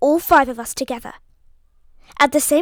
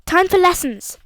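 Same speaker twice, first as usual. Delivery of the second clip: steady calling out; 5 semitones higher, 5 dB louder than usual. A narrator's reading. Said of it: "Her voice didn't shake at all".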